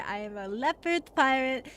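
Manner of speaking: usual speaking voice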